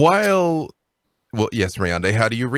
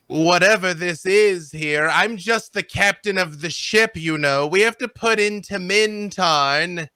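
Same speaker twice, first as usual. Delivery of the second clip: higher-pitched captain butting in with mock politeness; lofty, sarcastic ship captain; sardonic captain; 7 semitones higher, slower than usual. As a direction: interrupt grandly, feigning deference. GM plays sarcastic captain interrupting.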